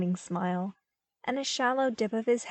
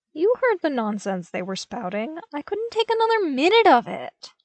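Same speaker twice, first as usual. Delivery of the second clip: rising, louder exasperated rant; exasperated complaint; 7 semitones higher, 6 dB louder than usual. A narrator's reading. Luke complaining to his commanders, higher and louder in exasperation. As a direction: vent the frustration. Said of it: "he groused".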